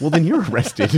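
laughing through the line. Real, not acted. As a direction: push the words out between laughs. Laughing punchline.

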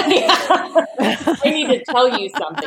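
retold line dissolving into laughter; laughing replay. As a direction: quote it back while cracking up. Replays phone line amid laughter.

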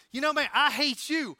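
put-on loud, high Southern accent; hammed-up Southern accent in a mock quarrel; Cal Naughton Junior. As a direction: throw it back loudly in an imitated Southern accent. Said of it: "how I can do that accent so well".

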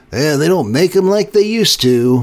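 imitating a grumpy old-timer, teasing; mocking old-timer. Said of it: "how old do you think it makes you sound".

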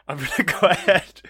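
loud, high laugh through the words. Real, not acted. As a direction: giggle out the line. Laughing while rolling dice.

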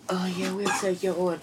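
soft, offhand worker voice; imitating cashier. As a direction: toss it off like a bored cashier. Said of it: "they was like".

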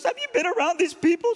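high, loud tearful wail, imitated; emotional outburst. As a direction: wail it as the overwrought cleaning lady. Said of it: "I'm sorry to be emotional".

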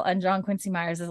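ordinary delivery

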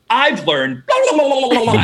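high-pitched comic babble; spewing. Retold or imitated. Eager applicant spewing editing terms at the boss, comic gabble. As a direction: spew it out in a mock gush of jargon. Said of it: "And I just spewed".

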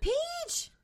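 high squeal of a pet's name; light up as the pet walks in; delighted greeting. Real, not acted. Host greeting her pet Peach, high and delighted.

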